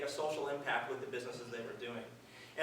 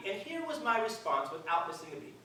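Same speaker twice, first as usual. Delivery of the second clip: normal talking voice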